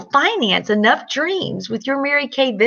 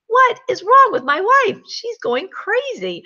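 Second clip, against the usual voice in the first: baffled husband; 11 semitones higher than usual. Retold or imitated. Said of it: "He's looking at me like".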